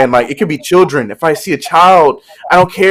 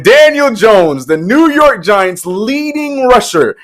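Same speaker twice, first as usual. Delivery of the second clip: mock announcer; 7 semitones higher, much slower than usual. Dramatic sports build-up.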